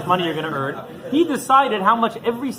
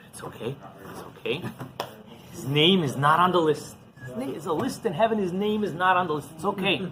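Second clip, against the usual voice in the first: soft whispered self-reassurance; hushed, holding back anger; calming himself; 6 dB quieter than usual. Retold or imitated. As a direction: talk yourself down under your breath. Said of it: "remembers what the Rebbe told him".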